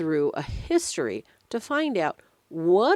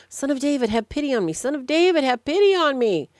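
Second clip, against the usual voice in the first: high, insistent repeated cry, building louder; calling out insistently; 8 semitones higher, 4 dB louder, faster than usual. Retold or imitated. Blind man begs Jesus for pity, calling out again and again. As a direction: plead higher, pushing louder on the repeat. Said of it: "kept calling out more and more and more".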